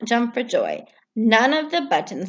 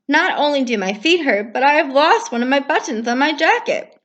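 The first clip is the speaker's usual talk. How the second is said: Toad, higher and a bit louder, fed up; mildly grumpy Toad; Toad grumbling; 6 semitones higher, 4 dB louder than usual. Acted. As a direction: grumble like a sore-footed toad. Toad's voice, complaining.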